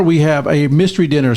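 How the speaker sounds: usual speaking voice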